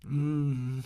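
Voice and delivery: plain reading voice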